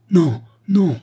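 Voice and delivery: high, loud, emotional denial; vehement, agitated outburst, pitch leaping up; violent cry of protest. A narrator's reading. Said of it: "s'écria l'hôtesse, avec beaucoup d'émotion et de violence".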